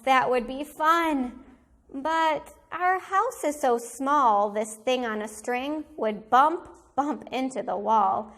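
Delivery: ordinary delivery, nothing marked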